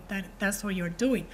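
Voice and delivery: usual speaking voice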